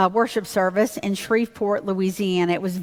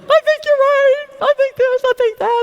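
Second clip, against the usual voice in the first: much higher and louder, words tumbling out in a panic; flustered panicked babble, high and loud; frantic blurting; 7 dB louder than usual. Retold or imitated. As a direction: blurt it all out in a rush of nerves. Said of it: "this is what I did".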